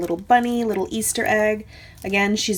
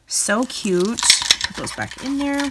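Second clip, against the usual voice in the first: normal talking voice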